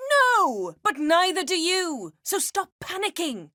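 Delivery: high heated retort; heated outburst. Acted. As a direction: cut off his panic. Heated rebuke.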